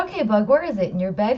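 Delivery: put-on a mother coaxing the truth out of a child; mom questioning kid. Mother at 1:30 am asking her son where the button went, brisk and coaxing.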